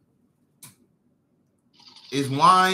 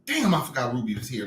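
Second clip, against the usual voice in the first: ordinary delivery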